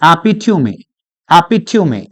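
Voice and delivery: एपिट्यूमी as "api-tyoo-mee", falling at the end; correct pronunciation. Shown as a demonstration. Teacher answers his own question for students, एपिट्यूमी as api-tyoo-mee. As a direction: sound all four syllables of एपिट्यूमी. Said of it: "correct pronunciation क्या होता है".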